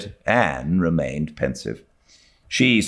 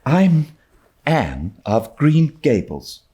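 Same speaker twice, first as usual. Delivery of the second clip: tremulous; 8 semitones higher than usual. A narrator's reading. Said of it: "said the small visitor tremulously".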